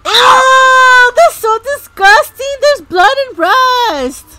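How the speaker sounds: high, loud cry of disgust; grossed-out squeal. Real, not acted. Player seeing blood in haunted house, disgusted squeal.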